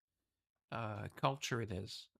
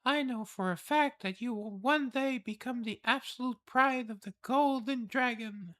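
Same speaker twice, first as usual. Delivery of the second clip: high, wondering noble voice; awed noble lady; 11 semitones higher, 5 dB louder than usual. Acted. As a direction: marvel at a hidden talent. Reads palace lord's lines.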